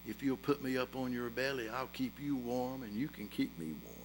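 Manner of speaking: hushed, intimate story voice; soft sermon illustration told low; quiet storytelling. A narrator's reading. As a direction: draw them into the tale softly. Quiet story of boy and snake.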